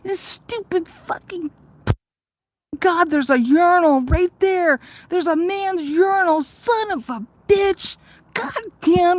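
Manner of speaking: high, slow exasperated cursing at the urinal; cursing in frustration. Retold or imitated. Cursing alone in the park bathroom, exasperated and high. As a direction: drag out each curse, fed up and disgusted. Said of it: "and I'm like".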